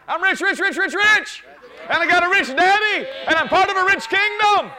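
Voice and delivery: high, loud exultant cry of riches; ecstatic shout. Real, not acted. Preacher exults over riches.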